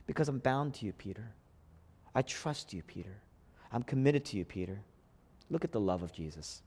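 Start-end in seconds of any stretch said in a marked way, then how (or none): 0.1-4.8 soft, low promise, Peter's name almost breathed; tender assurance. Acted. Jesus assuring Peter after the betrayal, softly and tenderly. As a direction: reassure him gently, fading on each name.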